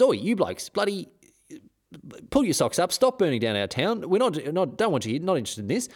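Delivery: a local yelling at soldiers to clear off, half-mocking; Aussie bloke telling off. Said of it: "he comes out, he says".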